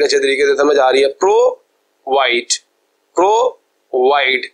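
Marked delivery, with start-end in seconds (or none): 1.1-2.5 provide said very slowly as pro, pause, wide; split into syllables. Shown as a demonstration. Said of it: "ये एक अलग आपके पास group". 3.2-4.5 provide said very slowly as pro, pause, wide; split into syllables. Shown as a demonstration. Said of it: "ये एक अलग आपके पास group".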